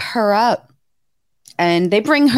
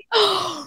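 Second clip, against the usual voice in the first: shocked gasp; 11 semitones higher than usual. Retold or imitated. Hearing about her guts.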